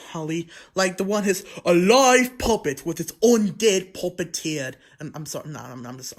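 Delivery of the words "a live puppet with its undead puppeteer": loud, slow, hammed-up vampire accent; vampire-accented puppeteer. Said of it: "like a vampire sounding accent".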